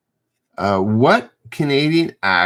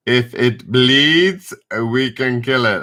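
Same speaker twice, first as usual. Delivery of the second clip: put-on horrible Arnie accent; 4 semitones higher than usual. Quizmaster doing the Predator quote for chat in a bad Austrian Arnie accent. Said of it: "that's a horrible Arnie accent".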